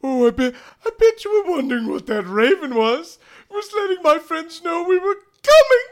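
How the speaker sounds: high, loud gloating villain voice; theatrical villain's reveal; Smithy. Acted. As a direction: taunt them in a piercing high pitch. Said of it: "and he goes".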